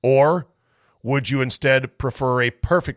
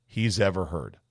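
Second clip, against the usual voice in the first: usual speaking voice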